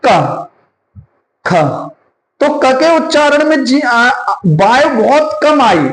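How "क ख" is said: क with little breath, then ख with a strong puff of air; aspiration demo. Shown as a demonstration. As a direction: contrast unaspirated क against breathy aspirated ख. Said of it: "जैसे मैं उच्चारण करता हूं".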